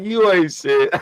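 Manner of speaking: loud, high laughing jab; wife's mock insult. Wife cracking up at her husband, loud teasing jab. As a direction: tease him like his wife did. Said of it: "She's like".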